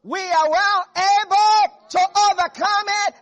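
high, loud triumphant proclamation; Caleb bold faith. Retold or imitated. Caleb urging the people, loud triumphant faith. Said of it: "Caleb said".

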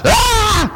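high shrieking yell of someone snapping; demonic outburst. Retold or imitated. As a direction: explode into a sudden scream. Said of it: "you're just like".

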